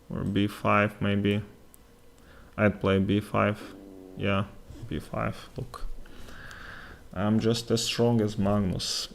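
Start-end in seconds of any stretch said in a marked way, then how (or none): none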